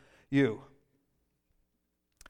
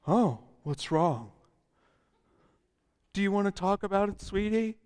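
a hint of a cooing worried parent, softer; gently pry, mock-sweet; mock concerned parent; 2 semitones higher, 4 dB quieter than usual. Pastor mocks prying family member, softer. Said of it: "No, don't do that".

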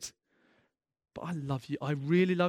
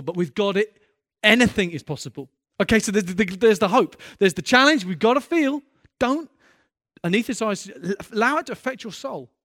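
loud, higher, pressing every phrase; impassioned preaching; 4 semitones higher, 9 dB louder than usual. Real, not acted. Preacher urging the church not to numb their hearts, loud and fervent. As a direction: drive the hope home to the room.

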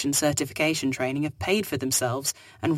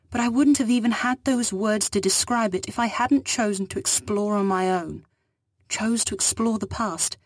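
higher, earnest stranger explaining herself; alien woman's voice; 5 semitones higher than usual. Acted. Fugitive explains her dangerous ideas to the captain, earnest. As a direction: speak as the fugitive, urgent and awed.

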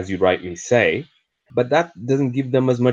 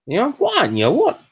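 put-on Geordie accent, much higher; 14 semitones higher than usual. Cafe girl repeats her question, in high mock Geordie accent. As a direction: mimic the cafe girl, pitched well up. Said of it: "in a thick Geordie accent".

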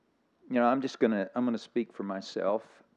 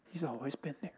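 choked up, very soft and higher; weepy; 4 semitones higher, 12 dB quieter, faster than usual. Real, not acted. Preacher moved by God's faithfulness through it all, weepy. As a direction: voice goes small and high, holding back tears. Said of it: "I'm a little weepy this morning".

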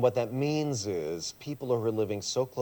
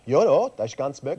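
a quick playful reply in Swiss German, a bit louder; speaking Swiss German; 3 semitones higher, 7 dB louder than usual. Shown as a demonstration. Asked if he could preach in Swiss German, answers brightly in it. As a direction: show off the first language, cheerfully. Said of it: "Could you give a sermon in Swiss German".

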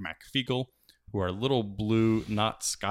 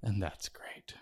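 whisper; 7 dB quieter, slower than usual. Real, not acted. Aside after the joke.

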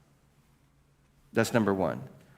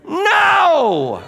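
shouted no; 9 dB louder than usual. Acted. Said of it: "answering own question".

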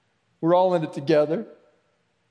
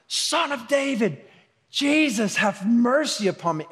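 screaming out; 7 semitones higher than usual. Retold or imitated. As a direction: cry out like a blind beggar begging. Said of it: "begins to scream out".

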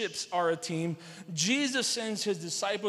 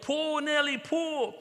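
higher-pitched call to a horse; farmer's yell; 7 semitones higher, slower than usual. Retold or imitated. Farmer urging his horse to pull, yelled. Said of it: "he hitched Buddy up to the car and yelled".

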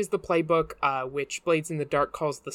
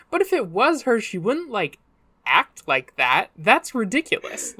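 higher-pitched incredulous townsfolk; mock disbelief; 5 semitones higher, 4 dB louder than usual. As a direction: scoff at the idea as if imitating everybody. Voices what everybody thinks of her.

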